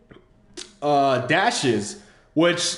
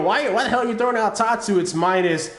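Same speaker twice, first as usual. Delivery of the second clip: higher, quicker mock-questioning viewer; imitating viewers' objection; 5 semitones higher than usual. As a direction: mimic an incredulous viewer, pitched up and hurried. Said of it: "what a lot of you guys will say".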